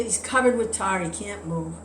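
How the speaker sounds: ordinary talk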